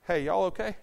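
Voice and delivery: ordinary delivery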